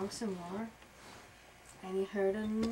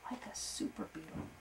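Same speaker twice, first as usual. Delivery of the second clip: plain reading voice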